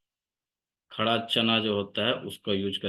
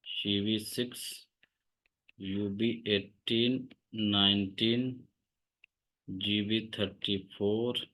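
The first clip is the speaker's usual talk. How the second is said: soft, slow point-by-point dictation; 6 dB quieter, slower than usual. Real, not acted. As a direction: read out each point slowly and softly for note-taking. Dictates treatment points slowly. (धीमी आवाज़ में रुक-रुक कर note लिखवाना)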